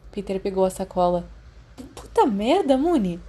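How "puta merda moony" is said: groaning under a heavy bag, louder; strained groan. Acted. Peter lifts Remus's heavy bag, strained groan. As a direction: swear as the weight drags you down. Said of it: "ele gemeu".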